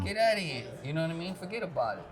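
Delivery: hammed-up New York Italian accent. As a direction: play the stock New Yorker, accent laid on thick. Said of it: "talk like you're from New York".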